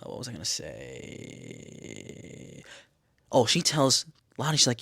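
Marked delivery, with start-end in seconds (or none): 0.0-2.6 low, very slow, soft drawl; thinking aloud. Real, not acted. Vlogger losing her thread mid-recap, absent-minded drawl. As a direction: stretch the last word out while searching memory.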